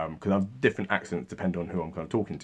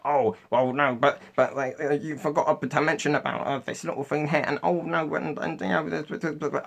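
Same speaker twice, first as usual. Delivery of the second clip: higher, rushed mock-whiny babble; whiny nitpicking commenters; 7 semitones higher than usual. Commenters objecting he forgot little things, high mocking babble. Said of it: "People in the comment section were like".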